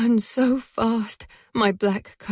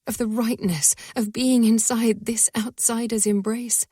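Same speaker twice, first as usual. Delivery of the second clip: ordinary delivery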